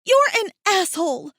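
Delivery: high, loud accusation; angry yell. A narrator's reading. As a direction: yell it at him in fury. Furious woman yelling at mate.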